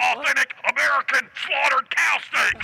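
high yelling rant; Alex Jones impression. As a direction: rant about steak like a zealot. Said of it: "It's Alex Jones".

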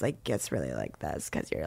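low creaky vocal fry demo. Shown as a demonstration. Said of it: "I was doing a lot of vocal fry".

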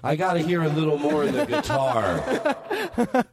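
pompous producer voice. Retold or imitated. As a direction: talk like a smug studio producer.

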